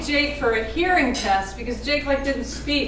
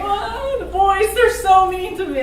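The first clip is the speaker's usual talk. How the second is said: put-on higher whiny little-girl wail, half laughing; mock crying; 7 semitones higher than usual. Little sister complaining to mom about brothers, mock-whiny crying. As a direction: imitate a sister tattling through fake tears. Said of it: "like, crying".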